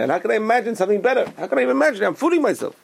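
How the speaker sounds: high-pitched, incredulous objection; exasperated protest. Acted. Doubter objecting that imagining is fooling himself, exasperated. Voices a sceptic protesting, stuck.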